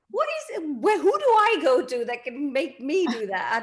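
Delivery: high-pitched, comically desperate question; mock-envious plea. Actress envying young YouTubers' projects, mock pleading. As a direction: wail it lightly in playful envy.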